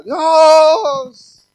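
loud, high, desperate cry to God; a man shouting to God across the field, crying out loud; retold shout. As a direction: yell it out, pleading to be filled. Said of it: "se oía desde lejos que gritaba".

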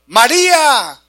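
loud, high shout of a name; shouted call. Acted. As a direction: cry the name out to get through to her. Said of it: "voy a gritar para que se preparen".

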